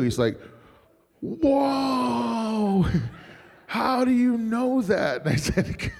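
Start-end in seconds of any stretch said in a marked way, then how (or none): 1.2-5.2 long, high astonished whoa; amazed fan. Retold or imitated. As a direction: draw it out in slow, high amazement. Acting out amazed fan's reaction.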